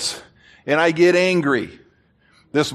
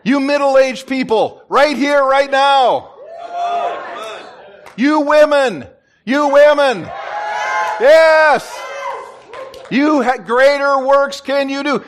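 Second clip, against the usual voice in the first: calling out; 5 semitones higher than usual. Real, not acted. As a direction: call each group out boldly. Calling out groups in congregation.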